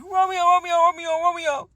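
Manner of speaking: high, loud mock wail of the name; godmom calling. Godmom on the phone, calling out in a high loud wail. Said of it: "and she's like".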